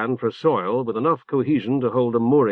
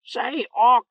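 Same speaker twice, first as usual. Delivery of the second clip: much higher, squawked like a talking bird; a bird crying out its message, urgent; urgent bird call; 12 semitones higher than usual. A narrator's reading. Orb the bird calling to Orc, urgent squawk. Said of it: "whistled sharply to emphasize the urgency".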